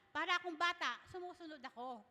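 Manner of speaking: normal talking voice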